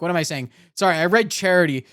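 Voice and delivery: ordinary delivery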